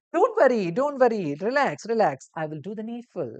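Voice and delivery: calm the frightened patient firmly; reassuring nurse. Acted. Nurse reassures a patient who fell ill, loud and kind.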